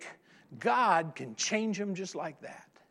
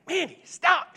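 higher, louder command to the dog; firm scold; 10 semitones higher, 5 dB louder than usual. Retold or imitated. Owner scolding the barking dog, louder. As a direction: snap at the dog.

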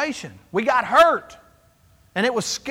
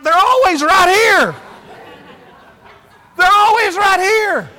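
louder, higher, frantic repeating; panicked; 8 semitones higher, 9 dB louder than usual. Retold or imitated. Pastor at Costco searching for missing ribs, panicked and loud. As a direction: blurt it like the ribs vanished. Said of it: "I'm panicking".

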